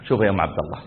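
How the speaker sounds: low, soft, careful opening; cautious husband. Retold or imitated. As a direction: ease into it quietly, voice dropped. Husband cautiously breaking news. (بصوت منخفض وحذر)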